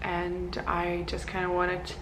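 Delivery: soft, odd-sounding voice just after breakfast; a just-woken voice that sounds off; strange morning voice. Real, not acted. Greeting viewers after breakfast, her voice sounding a bit strange and soft. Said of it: "my voice sounds a bit strange".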